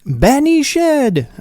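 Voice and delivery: very high, slow proclamation of "Benny Shed"; theatrical Benny Shed. Pretend. Clearing throat, then proclaiming it to viewers, mock Shakespearean. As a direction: declaim "Benny Shed" like a stage Romeo.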